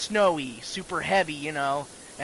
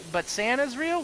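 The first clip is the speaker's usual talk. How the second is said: put-on questioning kid; 7 semitones higher than usual. Kid questioning dad in story.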